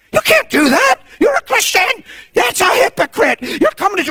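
high, indignant imitation of judgment; scandalized, hammed-up rebuke; mock scolding. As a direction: berate a confessor in parody. Acts out a judgmental counselor.

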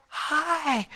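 high, soft breathy hello from a hospital bed; faint hi. Retold or imitated. Wife just out of a coma greets husband weakly. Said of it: "had a whisper 'cause of the trach".

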